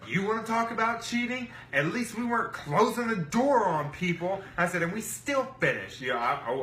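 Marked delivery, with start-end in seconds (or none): 0.1-4.4 high, raised indignant comeback; retold angry retort. Storyteller confronting the rivals about cheating, retold indignant anger. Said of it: "I was mad". 4.8-6.0 higher, indignant add-on; retold angry retort. Storyteller to the rivals about finishing, retold indignation. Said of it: "I was mad".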